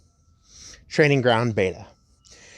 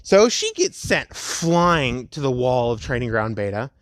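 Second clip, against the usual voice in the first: ordinary delivery, nothing marked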